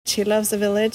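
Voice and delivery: normal talking voice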